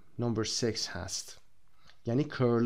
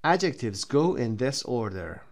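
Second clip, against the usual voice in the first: slow reading; much slower than usual. Shown as a demonstration. Teacher reads heading slowly.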